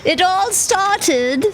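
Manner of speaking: high, slow storyteller opening; mock oratory. Co-host pretending to begin a long speech, mock-grand. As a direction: intone it like a grand speech, in jest.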